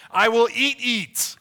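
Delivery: I will eat eat, high and loud with glee; greedy eager eating. Pretend. Preacher joking about Golden Corral cravings, gleeful loud. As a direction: shout it like a hungry kid at a buffet. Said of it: "when I go to Golden Corral".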